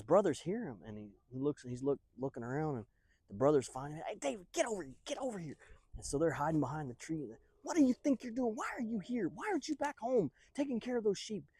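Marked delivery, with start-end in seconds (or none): 4.1-5.6 high, strained hushed call; urgent summons. Acted. Brothers call David into hiding, urgently. 7.6-11.4 high-pitched scolding questions; mock-exasperated, pitched way up; indignant scolding. Brothers scold David for leaving sheep, indignant.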